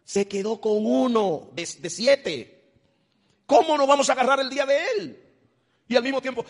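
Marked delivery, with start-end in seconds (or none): 3.4-6.4 high, loud rebuke to the flock; preaching shout. Real, not acted. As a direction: cry it out at the congregation. Preacher scolding congregation.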